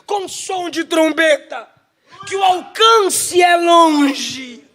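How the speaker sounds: loud and high, slowing to a shout; John's shout. Acted. John, turning to the trumpet voice, proclaims it in a loud high shout. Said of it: "Aí João diz assim".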